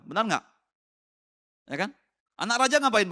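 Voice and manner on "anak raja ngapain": high, loud, fast question; mock yelling. Preacher asking the congregation about the neighbours' shouts, loud and high. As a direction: call it out like a teasing shout.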